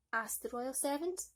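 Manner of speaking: ordinary delivery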